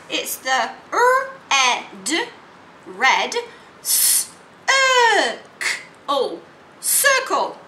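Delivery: segment each word slowly; sounding out. Shown as a demonstration. Teacher sounding out red circle. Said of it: "do the sounds and then say the whole word".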